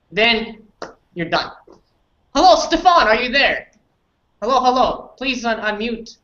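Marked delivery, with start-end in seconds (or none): none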